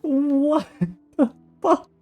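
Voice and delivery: stunned disbelief. Real, not acted. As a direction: shout in amazed disbelief. Disbelief after the jump.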